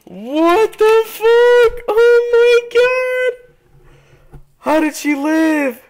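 very high, loud, slowly stretched shriek; drawn-out screeching shock; screaming disbelief. Real, not acted. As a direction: wail it out in total disbelief. Enemy survives impossibly.

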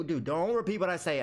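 ordinary delivery

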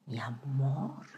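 soft, low murmur; hushed mock seduction; seductive ex. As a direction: purr it quietly like a lover. Plays seductive ex.

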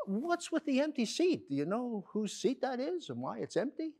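high-pitched nosy question; acted curiosity; curious inquiry. Curious woman asks stranger in joke.

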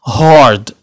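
هارد with a strong American R; American hard R. Shown as a demonstration. Teacher showing the American version, hard R. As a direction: sound the R clearly in هارد. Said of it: "كتنطق وكتكون هارد".

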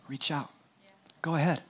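hushed, inviting call; soft invitation. Real, not acted. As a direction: draw them in softly.

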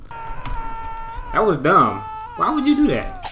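very high, slow mocking voice; mocking sing-song. Real, not acted. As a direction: stretch it out to taunt. Mocking the survivor.

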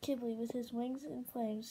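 ordinary delivery

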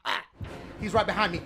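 usual speaking voice

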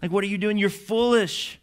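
mock scolding, climbing in pitch; lightly acted exasperation at foolish followers; exasperated rebuke. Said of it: "that's probably what he's thinking".

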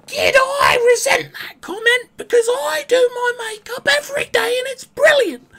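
very high, loud hammed-up crying shout; mock offended wail. Streamer faking offence at teammate Rose, high loud mock wail. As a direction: throw a fake tantrum, wailing high.

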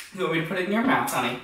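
normal talking voice

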